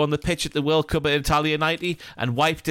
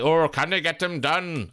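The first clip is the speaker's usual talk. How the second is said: slow, dramatic deadline-day reporter voice, played lightly; a touch of breathless transfer-news hype, drawn out.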